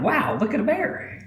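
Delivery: put-on high, slow, wide-eyed child's voice; boy in the truck bed marvelling at a bear; awestruck kid. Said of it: "And I'm excited".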